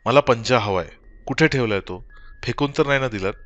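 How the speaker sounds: desperate insistence on getting the paw; frantic. Acted. Wife frantically demands the paw from her husband, urgent and loud.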